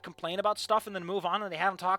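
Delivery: angry rant. Real, not acted. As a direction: complain hard to a friend. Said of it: "I'm pissed. I'm angry".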